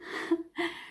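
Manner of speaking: sharp, airy gasp of pretend fright; mock gasp. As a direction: gasp as if the paint might bite.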